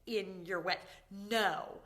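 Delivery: loud, appalled protest; horrified. Retold or imitated. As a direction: beg her not to have done it, aghast. Horrified reaction to friend peeing.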